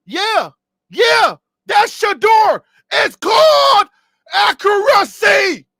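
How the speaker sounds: loud, high, triumphant shout; drawn-out shout on accuracy; hyped shout. Real, not acted. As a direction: yell it out like he just proved his point. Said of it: "get me out of character".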